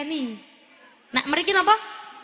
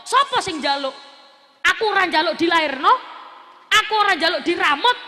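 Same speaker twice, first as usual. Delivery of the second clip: higher, louder mock-insolent retort; acted defiant child; 3 semitones higher, 5 dB louder than usual. Child answering back to parent, loud defiant retort. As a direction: throw it back at the parent, punching it out.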